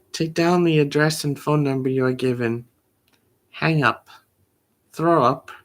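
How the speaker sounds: ordinary delivery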